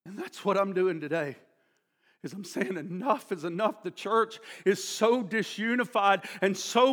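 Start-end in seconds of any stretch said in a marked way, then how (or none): none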